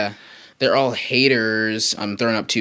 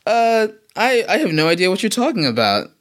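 feigned innocence; 10 semitones higher, 3 dB louder than usual. Pretend. Playing dumb about show ending.

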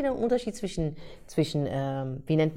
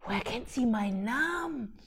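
slow, hushed mock bewilderment; quiet, dumbfounded wonder; retold astonishment; 3 semitones higher, 8 dB quieter than usual. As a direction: act stoned and baffled, drawing it out softly. Retold stoned astonishment at own name.

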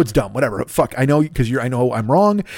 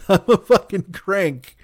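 laughing; 5 semitones higher, slower than usual. Real, not acted. Laughing at himself.

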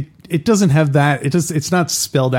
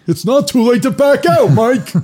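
high put-on voice mimicking the line; mock Hagen voice; 11 semitones higher, 5 dB louder, slower than usual. Friend parroting the gangster's line in a high mock voice. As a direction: toss the line back in a silly high voice.

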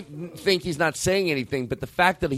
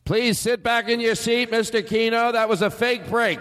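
higher, slow public address call; PA announcer voice; 6 semitones higher than usual. Acted. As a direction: announce it over the speakers. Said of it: "P A voice on".

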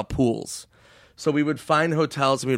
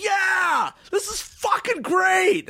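high, drawn-out party shout; mock ecstatic yell; much slower than usual. David on the dance floor, shouting over the music. David dancing, voiced in story.

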